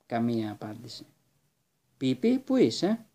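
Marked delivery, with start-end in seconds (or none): 2.0-3.1 higher and slow, worried shout; anxious call. Acted. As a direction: call for his missing friend, slow and high. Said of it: "φώναξε ανήσυχος". (ανήσυχο φώναγμα, πιο ψηλά και αργά)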